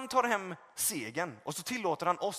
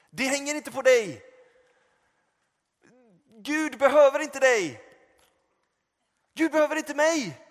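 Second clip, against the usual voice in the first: loud, high preaching, rising to a cry; preaching at full force; 7 semitones higher, 9 dB louder than usual. Acted. Preacher driving home the point to the congregation, loud and high. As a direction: hammer each line home to the congregation.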